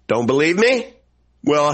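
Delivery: ordinary talk, nothing marked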